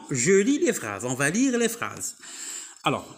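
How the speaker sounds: ordinary talk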